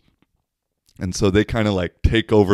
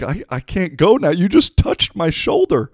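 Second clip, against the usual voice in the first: high, louder complaining imitation; whiny drunk guy; 11 semitones higher, 6 dB louder than usual. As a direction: whine the complaint pitched way up.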